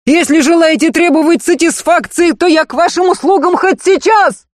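high heated challenge; furious challenge. A narrator's reading. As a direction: fling the challenge in fury. (яростно)

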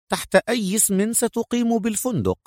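high feminine character voice; high, polite query; female agent, asking. Acted. Narrator voices Mona asking.

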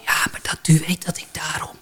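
quick voiceless whisper; soft, hurried whispered croak; throat-cancer voice. Retold or imitated. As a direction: imitate a barkeeper with no voice. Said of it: "zijn vingers op zijn keel".

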